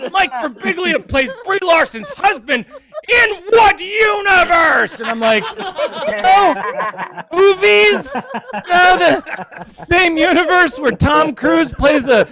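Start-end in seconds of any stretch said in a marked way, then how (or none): 0.1-5.0 incredulous, higher mock outrage, rising to a shout; hammed-up disbelief at the casting; mock internet outrage. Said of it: "the internet is angry about". 5.6-12.3 sarcastic retort. Retold or imitated. Retorting to internet critics.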